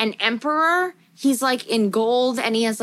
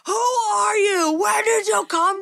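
demonic voice; 10 semitones higher than usual. Retold or imitated. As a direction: wail it like a demon from a nightmare. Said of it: "this, like, demonic voice".

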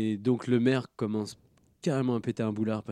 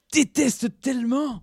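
emphatic; 12 semitones higher, 8 dB louder, slower than usual. Real, not acted. Ranting about hated character.

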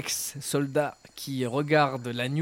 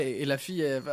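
ordinary delivery

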